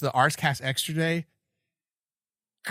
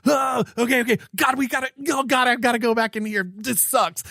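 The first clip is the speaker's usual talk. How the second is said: high-pitched exasperated groaning complaint; 8 semitones higher than usual. Retold or imitated. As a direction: act out dreading having to go back. Acting out a dismayed reaction.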